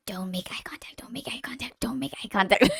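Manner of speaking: urgent whispered warning; whispering. Retold or imitated. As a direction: hiss the warning under breath. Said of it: "She's just like".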